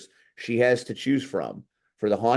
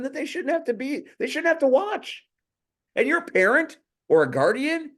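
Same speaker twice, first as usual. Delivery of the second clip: outraged; 12 semitones higher than usual. Real, not acted. Outraged rant about kids present.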